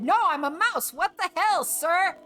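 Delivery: indignant mouse. Acted. Mouse retorting to the spirit.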